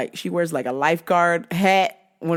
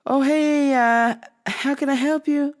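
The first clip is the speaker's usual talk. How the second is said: fake-polite clerk; 6 semitones higher than usual. Clerk greeting customer.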